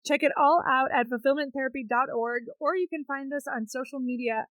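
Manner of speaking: upbeat promo host voice; polished announcer sign-off; podcast outro read. Acted. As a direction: wrap up the show like an ad.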